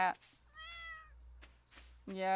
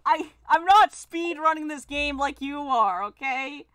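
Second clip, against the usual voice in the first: louder, higher defensive protest; exasperated retort; 5 semitones higher, 8 dB louder than usual. Real, not acted. Player defends their pace to a chatter, exasperated retort. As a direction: push back with exasperation. Snapping back at chatter Spooky.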